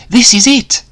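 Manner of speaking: high, eager cheer. Acted. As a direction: cheer as the ship lands, thrilled. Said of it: "Danny cheered".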